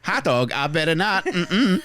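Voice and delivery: high, halting robot voice; stuck glitchy robot loop; glitching robot. Pretend. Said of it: "You just sound like a malfunctioning sex robot".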